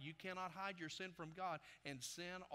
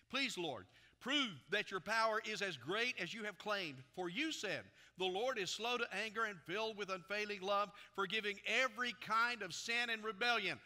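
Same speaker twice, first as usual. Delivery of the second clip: loud, raised preacher's reading voice; forceful scripture reading; 5 semitones higher, 8 dB louder than usual. A narrator's reading. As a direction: proclaim the Lord's words to the whole congregation. Reads scripture aloud at full force.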